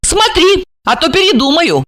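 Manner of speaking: high-pitched warning; threatening woman. A narrator's reading. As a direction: give a pert ultimatum in a high voice. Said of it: "пригрозила она".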